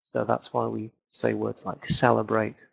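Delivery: usual speaking voice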